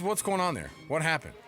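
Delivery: normal talking voice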